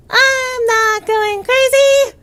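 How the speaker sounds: high, loud yell; shouting. Pretend. Yelled denial.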